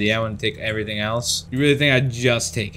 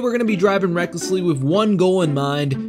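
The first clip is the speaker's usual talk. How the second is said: vlog intro; 8 semitones higher than usual. Acted.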